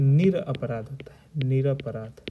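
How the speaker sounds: निरपराध said slowly, syllable by syllable; careful teacher pronunciation of निरपराध; correct spelling. Shown as a demonstration. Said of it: "निरापराध यह गलत है".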